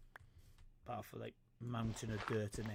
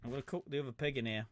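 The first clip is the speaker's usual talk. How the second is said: usual speaking voice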